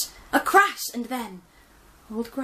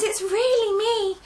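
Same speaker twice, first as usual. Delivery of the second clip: pleading; 14 semitones higher, 7 dB louder than usual. Acted. Gran pleads to pigs.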